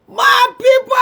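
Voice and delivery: loud excited exclamation; excited shout. Real, not acted. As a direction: shout it to the crowd. Reacting to live stream.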